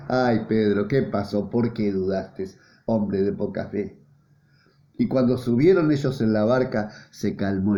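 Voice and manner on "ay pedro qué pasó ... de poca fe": softer and lower, gentle scolding; tender rebuke. Acted. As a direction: chide him kindly, like a caring friend. Jesus gently rebuking Peter.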